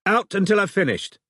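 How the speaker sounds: sharp high bark; curt order. A narrator's reading. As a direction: bark him out of the cubicle.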